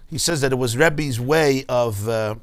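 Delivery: ordinary delivery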